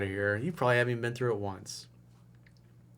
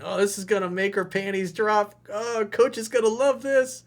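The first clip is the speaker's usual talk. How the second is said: high, gleeful mocking imitation; mock excited thinking; 8 semitones higher than usual. Coach mimics the emailer thinking his lines will impress, mock excitement. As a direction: imitate an eager guy sure he has nailed it.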